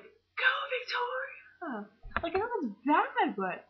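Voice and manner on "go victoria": "Go" shot up much higher, then "Victoria" drawn out slowly; excited cheer. Retold or imitated. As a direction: cheer her on like a fan in the theater. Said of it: "I was like".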